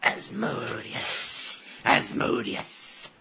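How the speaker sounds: whispered, drawn-out adder hiss; eerie slow whisper with trailing sibilance; creepy snake hiss. Retold or imitated. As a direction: hiss the name slowly like a snake. Imitating the creepy adder's name-hiss.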